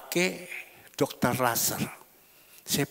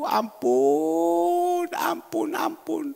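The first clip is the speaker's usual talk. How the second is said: high, fading cries of ampun; exclaiming ampun; 11 semitones higher, much slower than usual. Acted. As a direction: moan ampun three times as if shocked. Lament over wicked wives. (seruan ampun yang makin melemah)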